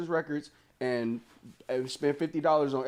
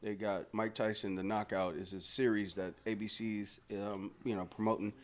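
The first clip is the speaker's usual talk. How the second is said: mild promo read; 7 semitones lower, 8 dB quieter than usual. Acted. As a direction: plug the show like an ad, lightly. Plugs a TV series.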